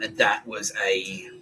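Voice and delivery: normal talking voice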